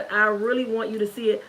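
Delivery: usual speaking voice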